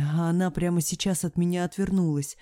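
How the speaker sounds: ordinary delivery, nothing marked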